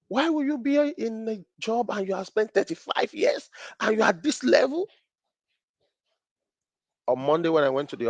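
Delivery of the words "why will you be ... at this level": incredulous. Retold or imitated. Incredulous question to Canadian colleagues.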